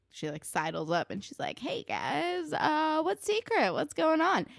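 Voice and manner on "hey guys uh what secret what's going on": nosy Ellie. Retold or imitated. Ellie sidles up curious.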